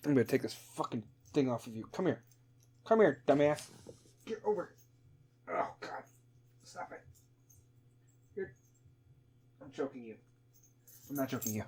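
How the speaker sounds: hushed, talking to the cat; quiet. Real, not acted. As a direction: murmur to a pet.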